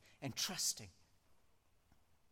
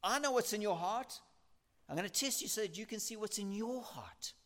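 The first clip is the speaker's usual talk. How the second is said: voicing God; 7 semitones higher, 3 dB louder than usual. Preacher voices God speaking.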